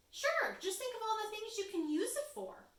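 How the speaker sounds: lightly high persuasive chicken. Acted. Chicken coaxing Mouse into the trade, high and eager. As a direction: coax him, up high and eager.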